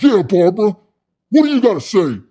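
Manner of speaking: high, loud confrontational demand; imitating a combative pitchman; aggressive challenge. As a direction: challenge her, voice jumping up. Snaps at Shark raising her hand.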